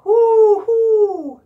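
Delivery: loud high mock owl hoo; owl hoot. Teacher hooting like an owl for the children.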